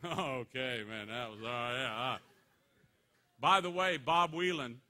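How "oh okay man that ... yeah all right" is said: mock unimpressed guys. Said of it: "And the guys go".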